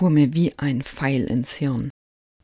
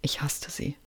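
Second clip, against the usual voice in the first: much softer, heavy and final; hushed hate; 12 dB quieter than usual. A narrator's reading. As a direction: breathe out the hatred.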